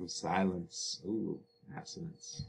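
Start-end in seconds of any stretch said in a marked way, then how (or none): 1.8-2.4 whispered. Real, not acted. Whispered word aside.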